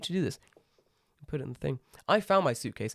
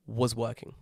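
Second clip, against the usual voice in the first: usual speaking voice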